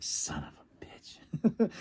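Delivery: slow, breathless hushed curse; whispered curse. Retold or imitated. Fan reacting to the F T R result, slow whisper. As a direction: whisper it in disbelief. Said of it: "I was like".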